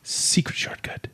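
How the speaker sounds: conspiratorial whisper. Pretend. Hosts joke about the Navy's shortcut, whispered. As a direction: hush it like a secret. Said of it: "whispered secret".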